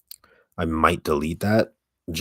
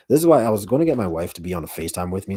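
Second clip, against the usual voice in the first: ordinary talk, nothing marked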